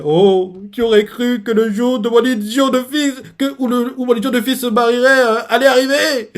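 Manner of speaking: weepy father voice, lightly hammed; mock weeping. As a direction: play the blubbering proud dad. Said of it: "il continue à pleurer".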